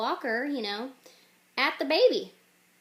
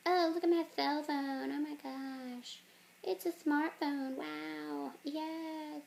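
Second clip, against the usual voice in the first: put-on angsty teenager; 5 semitones higher, slower than usual. Teenager admiring her cell phone, high put-on voice. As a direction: play a teenager cooing over a gadget. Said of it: "the teenager is like".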